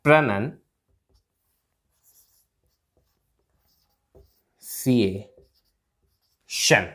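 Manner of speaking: प्रोनंसिएशन in pieces with very long pauses; syllable breakdown. Shown as a demonstration. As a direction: break प्रोनंसिएशन into pruh-nun, sie, shun. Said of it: "इसकी प्रोनंसिएशन ऐसे होगी". (टुकड़ों में, लंबे विराम के साथ)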